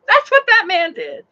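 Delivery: high, loud exclamation; excited admiration. Real, not acted. Praising the passenger who took action, excited admiration.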